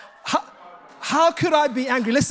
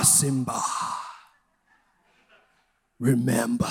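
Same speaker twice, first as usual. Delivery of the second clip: much lower, solemn fatherly voice; lion king father; 14 semitones lower, 4 dB quieter than usual. Retold or imitated. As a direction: sound like a great lion calling his son. Quoting the lion father to Simba.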